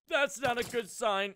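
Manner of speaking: high, loud frightened cry; scared outcry. Real, not acted. Gamer to viewers, lights going out in cave, high frightened cry. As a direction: blurt it out in sudden fear.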